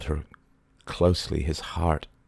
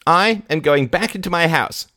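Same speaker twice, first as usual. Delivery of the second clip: high, firm loud declaration; decisively; 12 semitones higher, 5 dB louder than usual. A narrator's reading. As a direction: end the talk firmly. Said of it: "said Lady Barbara decisively".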